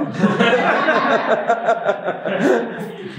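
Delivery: high, breathless laughing fit; real laughter bubbling over the story; helpless laughter. Real, not acted. Laughing at the memory.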